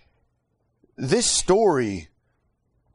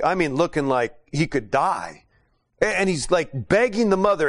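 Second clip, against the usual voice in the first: ordinary talk, nothing marked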